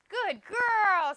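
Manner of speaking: very high, very loud praise; ecstatic praise. Real, not acted. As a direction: burst out with joy for the dog. Praising dog after success.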